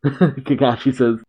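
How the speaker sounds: ordinary delivery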